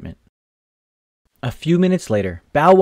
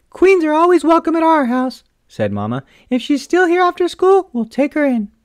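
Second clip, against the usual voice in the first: high, warm mother voice, a little louder; Mama's voice; 14 semitones higher, 4 dB louder than usual. A narrator's reading. Mother agreeing to keep the kitten, high and welcoming. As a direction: voice the mom much higher and kindly.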